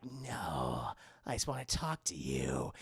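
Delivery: very low, soft mock drunk; drunk caller. Drunk caller wanting to talk, boozy. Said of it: "He sounds really drunk".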